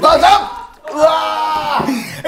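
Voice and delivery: high shriek, long drawn-out wail; startled scream. Real, not acted. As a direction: scream in a sudden fright. ゲーム中の驚きの悲鳴. (驚いて叫ぶ悲鳴)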